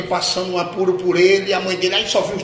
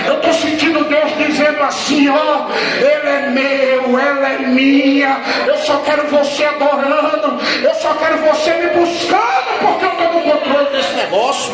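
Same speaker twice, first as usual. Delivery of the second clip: loud, high, stretched preaching shout; full-force preaching; 8 semitones higher, 7 dB louder than usual. Acted. Pastor urging the church never to stop praying, full-force shouting. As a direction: drive every word into the congregation.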